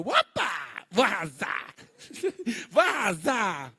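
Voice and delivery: playful flamboyant self-quote, hammed up; hammed-up excitement. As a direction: camp it up, bragging you'll slay. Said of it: "Aí eu". (brincalhão e exagerado, se achando)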